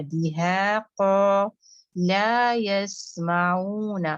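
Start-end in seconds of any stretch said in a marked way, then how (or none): 1.0-1.4 قا said louder and heavy from the back of the tongue; heavy qaf. Shown as a demonstration. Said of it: "زبان کو اچھے سے بچھانا ہے". 2.1-4.1 لا یسمعون recited very slowly, every syllable the same length; even-length recitation. Shown as a demonstration. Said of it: "آواز سب میں duration ایک جیسی".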